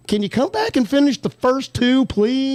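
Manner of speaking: high-pitched mock plea; pleading. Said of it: "and it's like".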